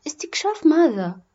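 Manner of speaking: high-pitched, lightly voiced child's question; puzzled boy. Boy asks mother about the trip, lightly voiced puzzlement. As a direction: ask as a puzzled little boy.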